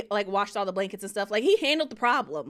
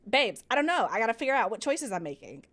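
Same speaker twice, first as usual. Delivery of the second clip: ordinary delivery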